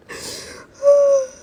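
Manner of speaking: a real upset sigh; loud high sigh. Real, not acted. Overwhelmed by the sad ending, loud high sigh.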